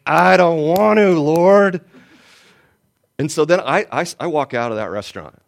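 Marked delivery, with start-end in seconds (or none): 0.0-1.8 stretched-out, louder complaint to God; loud whining protest. Retold or imitated. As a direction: push back loudly, like a reluctant child. Reluctant reply to God.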